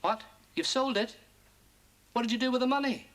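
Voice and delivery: usual speaking voice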